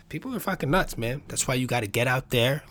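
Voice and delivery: usual speaking voice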